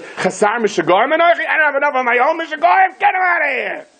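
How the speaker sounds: very high yell, climbing as it goes; exasperated scream. Acted. Achish orders the madman taken away, screaming in exasperation. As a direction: shout it like a king at the end of his rope. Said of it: "they were inside screaming their heads off".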